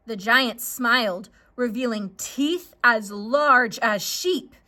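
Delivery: usual speaking voice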